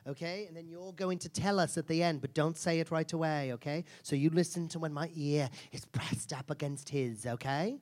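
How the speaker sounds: dropping to a hushed near-whisper. Real, not acted. Host quietly instructing the volunteer, hushed. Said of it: "what I whisper".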